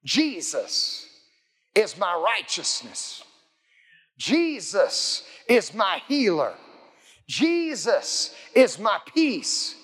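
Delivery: slow, measured proclamation with stretched words; preacher's declaration. Acted. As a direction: declare each phrase like a creed, pausing between words. Demonstrates confessing faith aloud.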